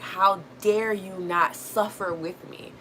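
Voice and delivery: mock outrage with swooping pitch; upset, indignant relative. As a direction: act out an offended parent demanding attention. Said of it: "people are going to be so upset".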